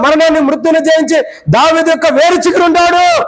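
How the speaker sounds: high-pitched, urgent proclamation; impassioned preaching. Acted. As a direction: build to a high, sustained scriptural proclamation. Impassioned retelling of scripture. (ఆవేశంతో ఎత్తైన స్వరంలో ప్రకటన)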